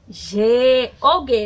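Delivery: teacher contrasting the two G sounds. Shown as a demonstration. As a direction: show both sounds of "G ou G". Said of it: "ela tem dois sons".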